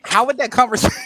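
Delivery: mock argument. Imagines him arguing with girlfriend.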